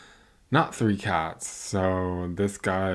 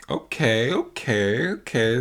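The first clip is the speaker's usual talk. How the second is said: loud, high rising okays; excited okays about the fancy date; hyped okays; 4 semitones higher, 4 dB louder, slower than usual. Real, not acted. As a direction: build hype with each okay.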